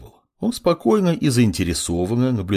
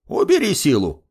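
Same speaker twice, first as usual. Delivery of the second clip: put-on high-pitched old man's grumble; grumbling old man; 7 semitones higher than usual. A narrator's reading. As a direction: grumble a brusque order in a raised pitch. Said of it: "буркнул старик".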